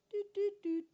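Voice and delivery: high, playful doot doot noise; sound effect vocals. Pretend. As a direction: hum a silly landing jingle. Mimics a landing sound effect.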